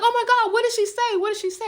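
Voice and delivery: very high, loud, breathless rush; bursting to hear the news; thrilled. Retold or imitated. Daughter told her mother was found, thrilled.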